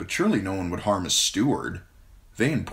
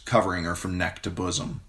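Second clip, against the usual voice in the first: ordinary delivery, nothing marked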